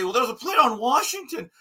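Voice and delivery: high, mocking voice of the critics; mock quoting of the critics. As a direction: mimic the so-called genius with scorn. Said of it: "the genius to say".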